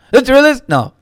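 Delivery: a high, loud mock Obama; squeaky send-up of the president; Obama impression. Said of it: "he goes".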